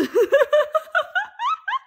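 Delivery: real high-pitched squeal of laughter. Real, not acted. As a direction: burst into a high shrieking laugh. Laughing at own impression.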